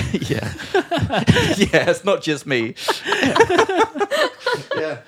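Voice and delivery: real laughter between slow words; laughing. Real, not acted. Joking about being the normal one.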